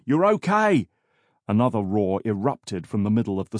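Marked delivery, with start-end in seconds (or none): 0.0-0.9 high, loud reassurance; calling out. A narrator's reading. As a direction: shout reassurance over a struggle. Officer calls out during the struggle.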